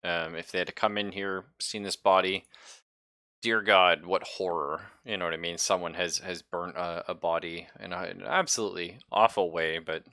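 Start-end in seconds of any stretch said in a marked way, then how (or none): none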